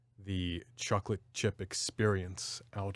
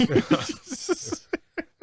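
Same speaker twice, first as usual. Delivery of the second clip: burst of laughter; 7 dB louder than usual. Real, not acted. Laughing at deformed ice cream faces.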